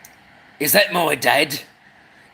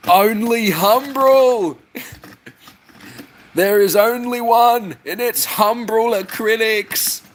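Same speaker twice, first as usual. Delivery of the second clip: mock decree; 7 semitones higher than usual. Boss decrees brand.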